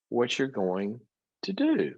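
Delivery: normal talking voice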